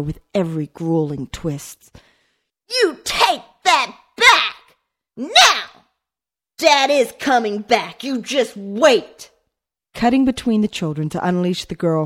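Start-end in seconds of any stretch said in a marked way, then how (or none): 2.7-9.3 high, loud, slow desperate shouting; tearful yelling. A narrator's reading. Boy yelling at his sister that Dad returns. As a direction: shout it, near tears. Children screaming over Dad.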